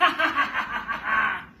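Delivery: a loud, high, boastful laugh. A narrator's reading. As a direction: laugh like a show-off spider. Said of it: "In his high-pitched voice, he laughed".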